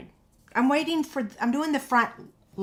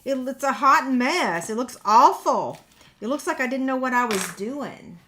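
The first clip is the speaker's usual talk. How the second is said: mock exasperation; 3 semitones higher, 8 dB louder than usual. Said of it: "And I'm like".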